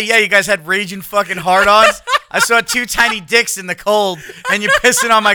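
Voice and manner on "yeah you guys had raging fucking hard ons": ordinary talk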